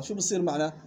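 ordinary talk

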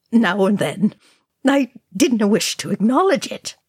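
reluctant admission with a touch of hoarseness; hoarse. A narrator's reading. Balin admitting what he ignored, slightly hoarse. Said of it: "Balin said hoarsely".

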